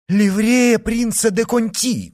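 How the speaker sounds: high, loud cry of news; excited exclamation. A narrator's reading. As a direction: call it out in excitement. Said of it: "воскликнул Блан-Миниль". (взволнованный громкий возглас)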